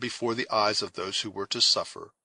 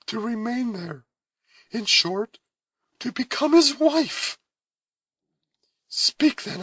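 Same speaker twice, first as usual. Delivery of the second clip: high, loud, pleading voice; anguished young woman; 14 semitones higher, 5 dB louder than usual. A narrator's reading. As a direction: beg them for counsel. Cora pleading.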